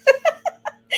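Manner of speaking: high loud laugh. Real, not acted. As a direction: burst out laughing. Both laugh at the joke.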